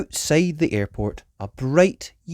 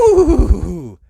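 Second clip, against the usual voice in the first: gasp; 10 semitones higher, 8 dB louder than usual. Acted. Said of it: "gasped Peppa".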